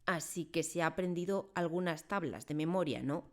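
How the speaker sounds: ordinary delivery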